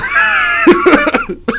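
piercing, very high burst of laughter; high shrieking laugh. Real, not acted. Host amused by the retold insults, high shrieking laugh. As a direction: crack up in a long squeal.

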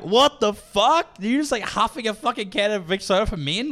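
high, loud disbelief, reenacted; acted-out "what on earth" outcry; incredulous outburst. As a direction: react in high-pitched, drawn-out shock. Retells reacting to man huffing deodorant.

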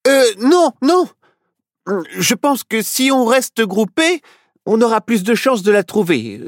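put-on high child voice, uneasy; a boy talking himself into courage; reassuring himself. A narrator's reading. Little Nao reassures his friends, high and unsure. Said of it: "se rassura Nao".

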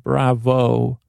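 ordinary delivery

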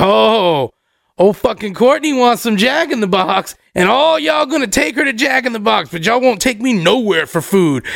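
put-on pissy drunk whine, pitch swinging up; mock a friend's sulky tirade; Rob. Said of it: "Rob starts getting pissy".

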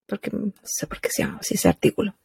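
whispered aside; whispering. Real, not acted. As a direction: drop to a whisper, half to yourself. Aside while reading headlines.

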